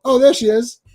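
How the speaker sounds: high cartoon voice, instant discovery; Terrence and Phillip. Host as cartoon Canadian spotting the daughter, comically casual. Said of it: "My favorite Terrence and Phillip".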